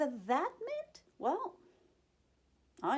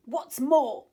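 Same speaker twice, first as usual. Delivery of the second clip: sharp, much louder retort; snapping; 5 semitones higher, 11 dB louder than usual. Acted. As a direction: bite the words off angrily. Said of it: "snapped the Lorax".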